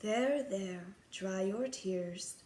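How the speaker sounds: soft, slow comfort; calm a crying girl gently; soothing. Acted. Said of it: "soothed a soft voice".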